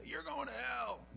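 mock street preacher, high and slow; mock the hellfire preacher with the bullhorn; lightly hammed-up condemnation. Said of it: "get in their face with a bullhorn".

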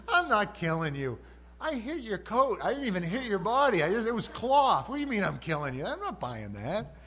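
loud, high, indignant retort; mock-outraged dad scolding; exasperated parent. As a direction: protest like a parent falsely accused. Exasperated parent answering wailing kid.

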